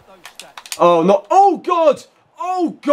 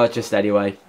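ordinary delivery

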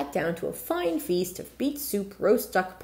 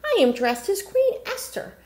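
higher, delighted exclaim; 13 semitones higher, 3 dB louder than usual. Acted. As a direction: sound thrilled at your new dress. Repeated with delight.

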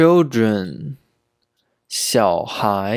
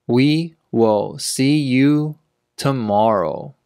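word by word, with clear gaps. Shown as a demonstration. Teacher repeats sentence slowly for learners.

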